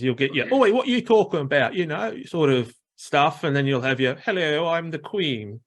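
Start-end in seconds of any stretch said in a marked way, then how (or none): 0.5-1.7 high, quick mock working-class English voice; lightly hammed-up working-class Brit. Describing English classes to Americans, mock working-class voice. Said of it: "same with English, like, uh, classes". 4.2-5.6 high, mock-posh royal voice; a hammed-up hint of a posh royal; the Queen. Describing English upper class, mock-posh royal voice.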